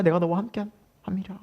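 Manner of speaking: hushed, unvoiced words of comfort; whispered promise. Shown as a demonstration. Preacher whispering God's comforting promise to the congregation.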